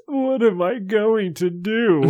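high, slow, whiny despair; despairing vampire kid. Acted. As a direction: wail it like a hopeless kid.

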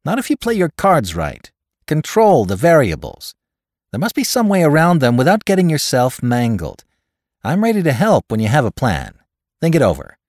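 high, loud, emphatic counsel; confident adviser. A narrator's reading. Caller counsels his friend on handling them, confident and emphatic.

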